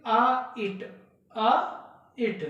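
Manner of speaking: "आ इट" slowly split into two sounds; drawn-out आ, separate इट, for learners; sounding out the rhyme. Shown as a demonstration. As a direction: say आ, pause, then इट. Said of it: "उच्चारण इसका".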